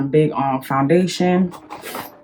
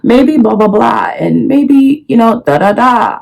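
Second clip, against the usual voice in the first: higher, louder mock arguing; playing the other side, pitched up; devil's advocate; 5 semitones higher, 6 dB louder than usual. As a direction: reel off placeholder arguments emphatically. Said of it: "I'm very devil's advocate".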